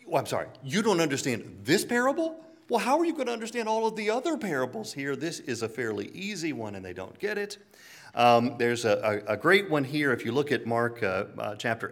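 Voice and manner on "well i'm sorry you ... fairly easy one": higher and fast, incredulous; Jesus baffled at his disciples, fast and incredulous; exasperated Jesus. Retold or imitated. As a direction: rattle off the disbelief, pitch climbing. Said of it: "Jesus looks at the disciples and like".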